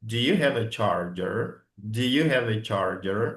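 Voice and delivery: a careful model question for learners; slow model sentence. Shown as a demonstration. Teacher slowly modelling the question for the class. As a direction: Do you have a charger? said slowly, charger with a curled r, rising end.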